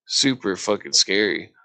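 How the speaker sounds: ordinary talk, nothing marked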